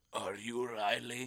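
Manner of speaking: slow, low teacher impression with a laugh. Teacher pointing at a kid, slow mock voice. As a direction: ask it slowly like the teacher. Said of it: "Points at me and goes".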